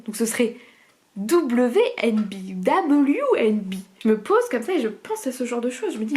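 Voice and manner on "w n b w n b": W N B spelled slowly and loudly. Shown as a demonstration. Puzzling over the logo for viewers, slow emphatic spelling. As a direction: spell out W N B letter by letter, rising on the repeat. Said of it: "Comment on dit vin en anglais".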